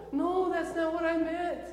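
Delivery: high, slow protest; pleading protest. Retold or imitated. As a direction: drag it out high, trying to explain yourself. Imagined protest to the teacher.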